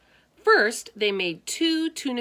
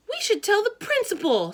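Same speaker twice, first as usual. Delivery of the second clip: put-on high, louder girl's voice; Harriet's voice; 6 dB louder, faster than usual. Harriet teasing George at lunch, high girlish voice. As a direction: pipe up as a chatty girl.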